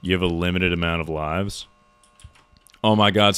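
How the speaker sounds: ordinary talk, nothing marked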